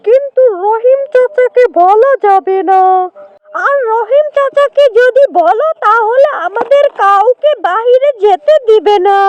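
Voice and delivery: high animal-character voice, persuasive; lightly voiced coaxing jackal. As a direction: lightly play a jackal luring new friends.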